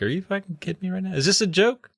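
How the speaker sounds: high, fast incredulous rant; can't believe what he's seeing; incredulous disbelief. Retold or imitated. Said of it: "even Danny's like".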